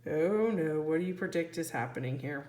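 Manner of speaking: usual speaking voice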